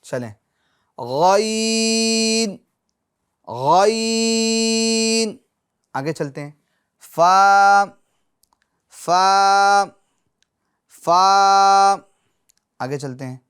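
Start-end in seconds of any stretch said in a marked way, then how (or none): 1.0-5.4 غین drawn out very long with a throaty gh; long sustained غین as in a Quran class; letter recitation. Shown as a demonstration. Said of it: "آگے والا حرف پڑھتے ہیں". 7.2-12.0 فا sounded loud and held; a loud held فا for students to repeat; letter recitation. Shown as a demonstration. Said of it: "آگے والا حرف پڑھتے ہیں".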